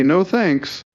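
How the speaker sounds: high-pitched imitated brush-off; mock polite refusal. South Korea turning down his request, mock high-pitched refusal.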